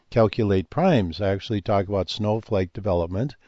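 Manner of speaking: usual speaking voice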